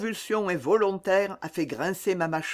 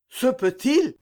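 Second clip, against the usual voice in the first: astonished; 7 semitones higher, 4 dB louder than usual. A narrator's reading. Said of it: "Tout le monde à la fois pour lors dit".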